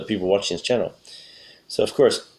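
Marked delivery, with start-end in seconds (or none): none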